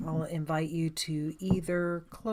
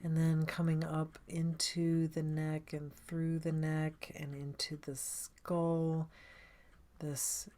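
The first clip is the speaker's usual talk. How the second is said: guided meditation; 3 dB quieter than usual. Acted. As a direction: guide them gently inward, leaving space after each phrase.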